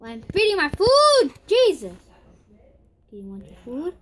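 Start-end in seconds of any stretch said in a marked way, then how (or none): 0.0-1.9 high, loud yelp of annoyance; exasperated outburst. Real, not acted. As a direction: shout it in high-pitched exasperation. Annoyed outburst while feeding.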